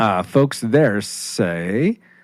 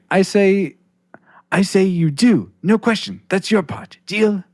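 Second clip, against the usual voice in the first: high-pitched hammed-up Mister Miyagi accent, sage teacher; 9 semitones higher than usual. Podcaster reads fan comment in Mister Miyagi accent. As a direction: playfully imitate Mister Miyagi's accent, quoting the movie.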